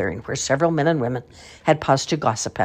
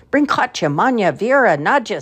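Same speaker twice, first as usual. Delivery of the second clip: commanding call; 7 semitones higher, 5 dB louder than usual. A narrator's reading. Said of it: "said the tall man".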